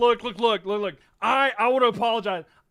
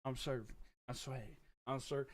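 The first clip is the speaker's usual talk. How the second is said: much softer, lower muttering; quiet mutter; 9 semitones lower, 21 dB quieter than usual. Real, not acted. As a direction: mumble it to yourself. Muttered aside, very soft.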